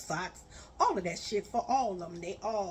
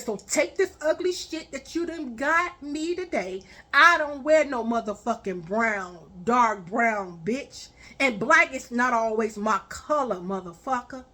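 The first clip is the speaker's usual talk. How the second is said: louder and faster, scolding; angry rant; 7 dB louder than usual. Retold or imitated. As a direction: tear into him, raising your voice. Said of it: "I said".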